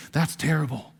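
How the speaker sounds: hushed gossip aside; secretive whisper; gossipy whisper. Retold or imitated. Voicing a gossiping friend.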